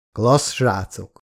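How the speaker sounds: slow, polite compliment. A narrator's reading. Lex responding to the father's photos, polite. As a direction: say it courteously, taking your time. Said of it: "mondta Lex udvariasan".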